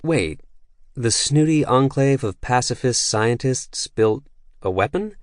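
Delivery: rising, baffled disbelief climbing to a high weapon; confused. A narrator's reading. Lucia, startled the pacifists built a weapon, confused. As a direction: let the question shoot up in confusion. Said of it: "Lucia sounded confused".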